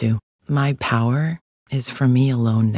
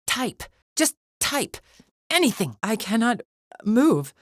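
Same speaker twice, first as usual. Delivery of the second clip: higher-pitched, halting exasperation; frustrated struggle; 8 semitones higher than usual. Acted. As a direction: strain against a body that will not obey. Frustrated struggle to act when frozen.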